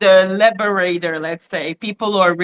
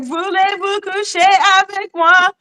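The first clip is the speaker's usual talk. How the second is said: singsong French pickup line; 9 semitones higher than usual. Retold or imitated. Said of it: "They're like".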